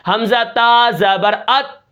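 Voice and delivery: teacher spelling out the syllable ات slowly; slow spelling demo. Shown as a demonstration. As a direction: spell out حمزہ تا زبر ات slowly, ending ات with a light breath. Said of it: "جیسے مثال کے طور پر".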